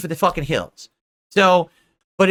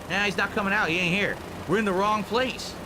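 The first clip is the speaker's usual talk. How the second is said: ordinary delivery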